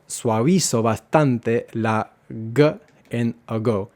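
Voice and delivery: ordinary talk, nothing marked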